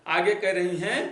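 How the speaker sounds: usual speaking voice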